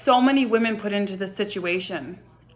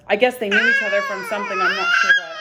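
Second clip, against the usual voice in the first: sobbing through the words, rushed; crying; 5 dB louder, faster than usual. Real, not acted. Recalling meeting him in the mall, voice breaking into sobs. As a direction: pitch jumps way up and cracks into tears.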